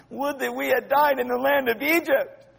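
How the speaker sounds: hammed-up high-pitched grumbling; grumbling complaint. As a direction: moan it like an aggrieved crowd. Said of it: "They grumbled against Moses and Aaron".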